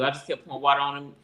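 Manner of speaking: ordinary delivery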